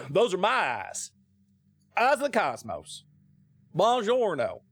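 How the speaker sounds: hammed-up Italian with drawn-out words; Brad Pitt. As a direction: ham up a clumsy Italian accent. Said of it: "sound like Brad Pitt trying to do an Italian accent".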